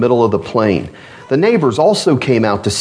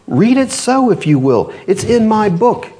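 much higher pitched urging; urgent insistence; 11 semitones higher than usual. A narrator's reading. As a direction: press them hard to read it. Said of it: "And he said".

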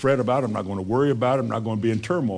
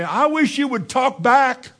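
high, slow yearning plea to God; a believer pleading with God to answer; pleading prayer; 12 semitones higher, 5 dB louder than usual. Retold or imitated. Voicing a praying believer.

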